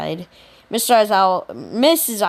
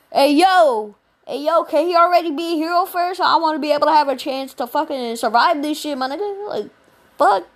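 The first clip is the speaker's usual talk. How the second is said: panicked classmate; 6 semitones higher than usual. Retold or imitated. Classmate begging before the test, high and fast. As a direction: squeak out a frantic plea.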